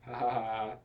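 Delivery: deadpan read-out laughter; half-hearted laugh. A narrator's reading. Narrator voicing the characters' laughter, half-hearted. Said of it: "And they laughed".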